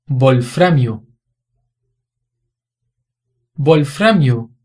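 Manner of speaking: "wolframio" with the W said as a B, bolframio. Shown as a demonstration. Teacher demonstrating the German-origin W as B. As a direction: start "wolframio" on a firm B. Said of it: "Tem som de B".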